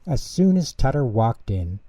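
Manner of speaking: usual speaking voice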